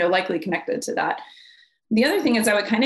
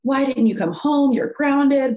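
put-on raised, higher-pitched scolding of a teen; mock parental yelling; 7 semitones higher, 3 dB louder, slower than usual. Parent confronting a teenager home late, mock yelling. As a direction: play an exasperated parent telling off a child. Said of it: "I'd yell at them and say".

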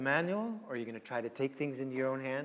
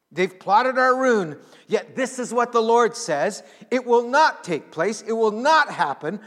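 preaching at full force; 3 semitones higher, 10 dB louder than usual. Acted. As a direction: declare it like a prophet before the king. Loud prophetic proclamation.

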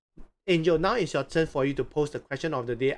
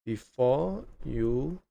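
very slow, word by word, long pauses; slow dictation pace; 2 semitones lower, slower than usual. Real, not acted. Host repeating guest's question to write it down, slowly. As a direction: repeat it haltingly as if writing it down. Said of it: "can you repeat again slowly".

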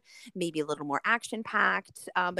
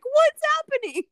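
baffled shriek; 9 dB louder than usual. Retold or imitated. Reacting to surprise cartoon screening.

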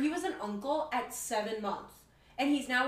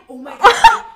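loud, laughing disbelief; laughing shock; 4 semitones higher, 23 dB louder, much faster than usual. Real, not acted. Friend retells her shocked reply to date, laughing shock. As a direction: burst out through laughter, scandalized.

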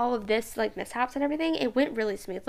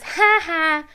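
mocking laugh; 6 semitones higher, 8 dB louder than usual. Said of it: "it really sounded like she was laughing".

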